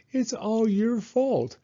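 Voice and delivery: put-on high, slowed child's complaint; upset little girl. A narrator's reading. Little girl scolding her father from the shelf, high and upset. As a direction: blame dad, near tears. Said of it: "Taya said".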